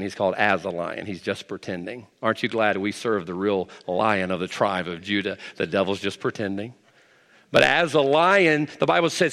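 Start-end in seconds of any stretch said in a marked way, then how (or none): 0.0-6.7 much lower and softer, confiding; quiet aside. Acted. Pastor quietly tells the church the devil only pretends. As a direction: drop right down and let them lean in.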